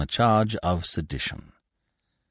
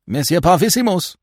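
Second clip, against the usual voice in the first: higher, louder call after him; 11 semitones higher, 7 dB louder than usual. A narrator's reading. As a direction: call him back across the street. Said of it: "Monsieur Binet called him back".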